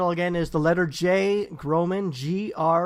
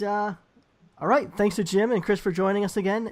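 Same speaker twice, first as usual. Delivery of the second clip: ordinary talk, nothing marked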